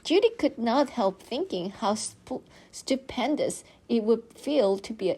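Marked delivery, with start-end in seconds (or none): none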